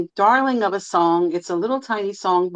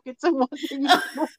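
words broken by laughter; giggling through it; laughing; 7 semitones higher, 4 dB quieter than usual. Real, not acted. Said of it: "now I can't stop laughing".

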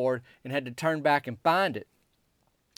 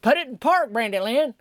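higher, louder buddy echoing the order; Myron chiming in; 9 semitones higher, 5 dB louder than usual. Retold or imitated. Friend Myron joins in ordering her to stop, loud and high. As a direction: back up your friend with a high shout. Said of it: "Myron chimes in".

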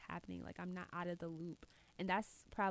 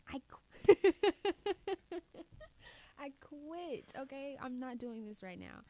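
exasperated; 5 semitones higher, 5 dB louder, slower than usual. Acted. Acting out quitting fight.

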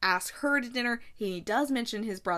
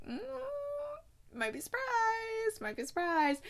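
teasing singsong. Acted. Recapper hinting at the secret number, teasing singsong.

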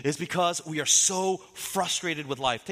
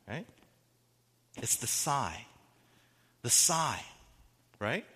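normal talking voice

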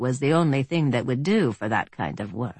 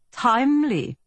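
put-on higher-pitched German-accented villain; smooth German accent, pitched up; 10 semitones higher than usual. A narrator's reading. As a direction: speak with a refined German accent. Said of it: "in a fine German accent".

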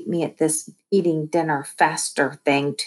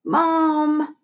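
calling out; 11 semitones higher, 5 dB louder, much slower than usual. A narrator's reading. Child calling out to mother.

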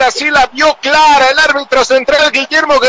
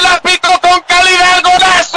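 rising goal call; 6 semitones higher than usual. Real, not acted. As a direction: pitch climbing into the goal call. Goal scored.

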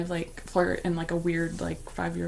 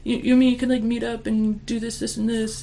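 high, eager pitch of a clueless flirt; 5 semitones higher, 4 dB louder than usual.

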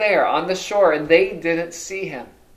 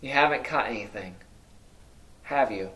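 softer, faster, said as a plain statement; quieter flat statement; 5 semitones lower, 7 dB quieter than usual. Shown as a demonstration. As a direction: drop it low and quick, no real question. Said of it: "written more like a statement".

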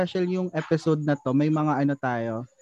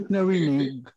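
lightly put-on conyo drawl on narinig; mock arte voice; 3 semitones lower, 3 dB quieter, slower than usual. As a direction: tease with a touch of prissy Manila voice. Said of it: "Kailangan dapat arte yung boses natin".

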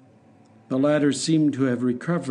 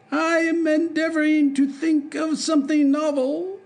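much higher, distant dwarf voice; dreamily, mind elsewhere; abstracted dwarf. Hop Frog answers the king, high and abstracted. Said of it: "replied the dwarf abstractedly".

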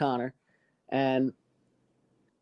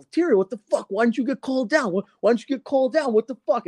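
higher, mock-angry buddies demanding answers; a hint of outraged teen friends; friends confronting; 8 semitones higher than usual. Friends confront him after detention.

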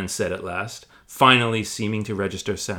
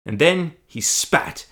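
ordinary talk

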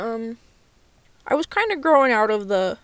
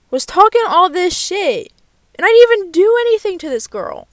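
louder, higher indignant rant; heated outburst; 8 semitones higher, 8 dB louder than usual. Real, not acted. Venting to viewers about the bully, loud and indignant. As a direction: rants louder and higher at the memory. Reliving being bullied, heated.

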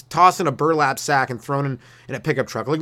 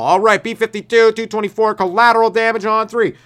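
loud, high quarterback calling the play; football play call; 7 semitones higher, 6 dB louder than usual. Pretend. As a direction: bark it out like a quarterback at the line. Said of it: "sounds like a move in football".

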